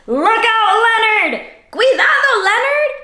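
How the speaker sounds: high, loud bilingual title call; excited call. Acted. Host reading the title to kids, excited. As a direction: announce the title with a big call.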